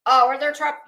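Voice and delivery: normal talking voice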